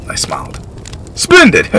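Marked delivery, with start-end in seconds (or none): 1.1-1.8 high, loud jovial welcome; jolly host. Acted. Beckett welcoming the boy to dinner, high jolly boom. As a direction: boom with greedy delight.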